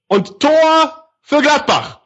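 slow, high drawn-out goal cry; goal call. Real, not acted.

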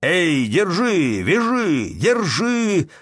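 high, loud, drawn-out yells; crowd shouts. A narrator's reading. Peasants all over the tavern yell to seize the tramps, shouting. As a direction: call out to grab them. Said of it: "раздалось со всех сторон в кабаке".

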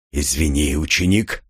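low, mildly tense voice; a touch of strain, pitched low; Кир. A narrator's reading. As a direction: speak low with understated tension. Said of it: "раздался в голове напряжённый голос Кира".

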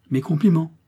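half-kind, half-mocking. A narrator's reading. Prince greeting the engaged couple, smiling and teasing. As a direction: congratulate them with gentle mockery. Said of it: "dit-il en souriant, d'un air moitié bienveillant, moitié railleur".

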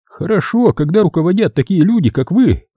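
high, earnest character voice; sincere doctor. A narrator's reading. Doctor answers Troy about those in charge, sincere high voice. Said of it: "вполне искренне ответил врач".

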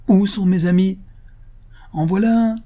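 heated, high-pitched outcry; impassioned outburst. A narrator's reading. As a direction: throw the question out in anguished protest. Sick man's bitter outburst to doctor.

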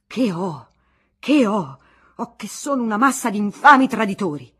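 loud, raging outburst; furious shout. Acted. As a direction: let the fury explode. Furious husband bursts in.

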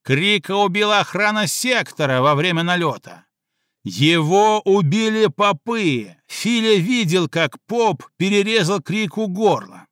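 Крыщак accusing the priests before the gang; heated accusation. Acted. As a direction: hammer home the accusation.

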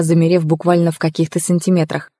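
usual speaking voice

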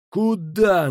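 high, loud alarmed question; straining yell. A narrator's reading. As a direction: yell it in disbelief. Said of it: "надрывался Фиш".

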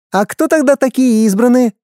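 put-on high, light girl's question; little girl voice. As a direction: ask like a curious young girl. A girl asks the teacher.

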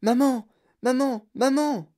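high, loud cries for mom; child bursting in, calling out high; excited calling out. A narrator's reading. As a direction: call out to mom, high and eager. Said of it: "Il cria".

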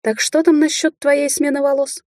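high, sustained chanting question; singsong taunt. A narrator's reading. Witch closing in on frightened rival, singsong taunt. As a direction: sing it out to intimidate. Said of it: "пропела я".

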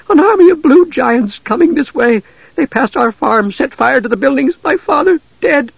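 put-on a frightened girl telling of her father's death; panicked farm girl. A narrator's reading. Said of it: "the young woman gasped".

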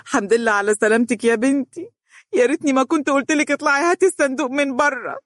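high, grieving lament; anguished. Acted. Mother at her daughter's bedside, high anguished lament. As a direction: pour out guilt and relief. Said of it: "بلوعة".

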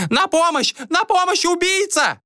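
very high shrieked cry for help; screaming woman. A narrator's reading. Lady-in-waiting raises alarm at intruder, high screaming. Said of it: "Женский голос, незнакомый Джориану, закричал".

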